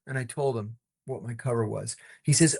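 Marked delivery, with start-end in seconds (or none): none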